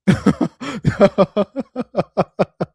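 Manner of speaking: high burst of laughter. Real, not acted. Laughing at Instagram reaction.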